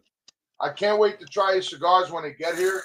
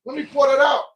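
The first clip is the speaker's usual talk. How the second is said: higher, louder retold boast; loud imitation of Ish; 7 semitones higher, 7 dB louder than usual. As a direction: belt it out like the rowdy friend. Ish quoted, loud and high.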